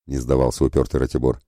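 ordinary delivery, nothing marked

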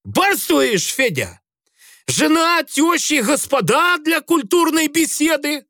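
put-on high, scornful drunk taunt; mock the lord of the manor; taunting sailor. A narrator's reading. Грицай taunts the commander. (издевательски)

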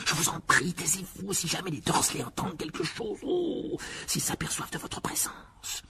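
high, strained whispered pleading; urgent murmur. A narrator's reading. Harry begging the elf to be quiet, urgent whisper. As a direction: plead in a hush, fearing discovery. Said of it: "murmura précipitamment Harry".

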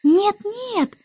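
put-on high, fretful child voice; impatient interruption. A narrator's reading. Baby Annabel interrupts the starling, high and impatient. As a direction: cut in like an impatient little girl. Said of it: "нетерпеливо перебила Аннабелла".

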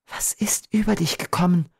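high, whispery murmur of dismay. A narrator's reading. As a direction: murmur it faintly, almost voiceless. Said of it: "murmelte Beatrice".

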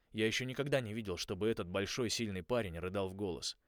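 plain reading voice

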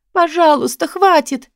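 high, pained begging; moaned plea. A narrator's reading. Woman begging between blows, moaning plea. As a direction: plead desperately for it to end. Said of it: "простонала осипшим голосом".

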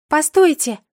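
high, loud call upward. A narrator's reading. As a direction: shout after the flying birds. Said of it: "крикнула я".

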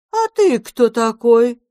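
be startled by the little stranger; cat asks the house-spirit in surprise who he is; surprised question. Acted. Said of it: "удивлённо спросила".

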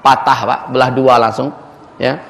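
usual speaking voice

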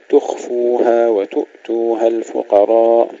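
slow chant, "تخفوها وتؤتوها" with still خ and ء; no qalqalah. Shown as a demonstration. Teacher recites the phrase correctly, no qalqalah. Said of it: "لا نقلقل لا الخاء ولا الهمزة".

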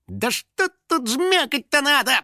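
high, slow, puzzled character voice; flustered grumbling. A narrator's reading. House spirit trying every bike control, flustered grumbling. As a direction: fumble aloud in a high little voice. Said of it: "бормотал домовой".

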